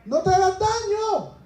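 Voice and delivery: high, urgent call to stop him; urgent shout. Retold or imitated. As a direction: cry out to stop the jailer. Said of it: "Pablo grita fuertemente". (grito urgente para detenerlo)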